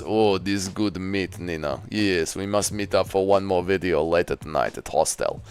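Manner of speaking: low, quiet, leering put-on voice; soft, deep impression of a sleazy trafficker; Armin. Trafficker arranging a late video with a woman, in a low role voice. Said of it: "Impression of human trafficking, Armin slapping Nina's ass".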